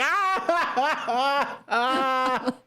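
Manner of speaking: series of high hammed-up wails; acted pain yelps. Kid hit by dad's plastic slipper, acted pain yelps. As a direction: yelp high at each imagined smack. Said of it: "That one, you felt it".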